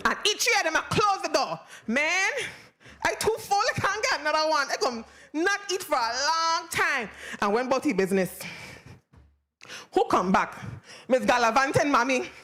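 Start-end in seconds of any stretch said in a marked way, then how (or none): none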